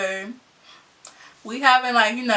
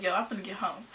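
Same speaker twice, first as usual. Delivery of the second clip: much softer, muttered to herself; mumbled brush-off; 5 semitones lower, 14 dB quieter, faster than usual. Retold or imitated. Speed walking home past him, quiet mutter. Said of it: "I was like".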